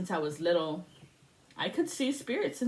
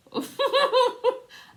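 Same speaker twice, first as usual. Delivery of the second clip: a big burst of laughter, pitched high; loud high laugh; 8 dB louder than usual. Real, not acted. Remembering the school paddle, loud laughing. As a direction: cackle at the memory out loud.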